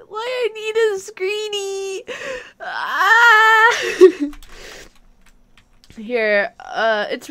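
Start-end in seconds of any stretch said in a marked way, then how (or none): 0.1-2.0 high-pitched fan plea; whiny mock pleading. Mimics fans begging for screenshots. 2.6-4.3 loud, high-pitched scream; high mock scream. Mock scream at overwhelming crowd.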